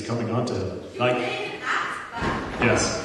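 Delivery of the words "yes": ordinary talk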